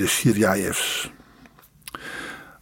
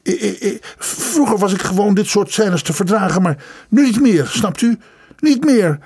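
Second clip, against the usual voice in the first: agitated protest; 8 semitones higher than usual. Acted. Son stammers back at father.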